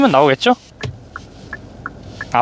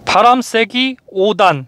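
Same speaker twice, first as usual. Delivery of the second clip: slow, higher, carefully spaced words; very slow command; 4 semitones higher, much slower than usual. Shown as a demonstration. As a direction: spell it out slowly for the machine. Voice command to car.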